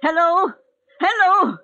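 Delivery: high, loud hellos climbing in panic; frantic calling. Acted. Invalid alone shouting into a dead phone, frantic.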